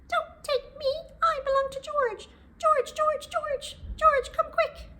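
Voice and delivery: very high, loud squeaky cry for help; little harp voice. Acted. The harp calling George to stop the thief. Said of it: "a little voice spoke up".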